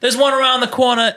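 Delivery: high mock New Yorker; New York accent. Italian local giving a tourist directions, high New York accent. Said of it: "That was a New York versus Brooklyn".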